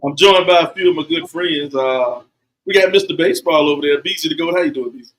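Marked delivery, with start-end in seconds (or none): none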